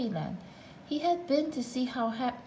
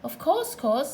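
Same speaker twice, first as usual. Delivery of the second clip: high, cheerful doubled reply; a bright, smiling yes with the echo; smiling twin; 5 semitones higher, 4 dB louder than usual. A narrator's reading. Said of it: "smiled Little Miss Twin".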